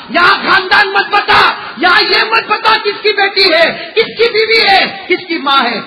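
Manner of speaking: loud, high, slow thundering reply; booming grave voice. A narrator's reading. Voice answers from the grave. (قبر کی گرجدار بلند آواز)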